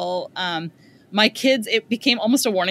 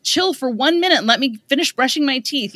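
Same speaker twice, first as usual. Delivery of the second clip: exasperated parent; 5 semitones higher, 4 dB louder than usual. Retold or imitated.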